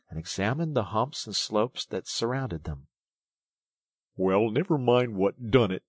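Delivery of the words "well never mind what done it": louder, put-out grumble; pained. A narrator's reading. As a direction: brush it off while hurting. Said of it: "forgetting his grammar in his pain".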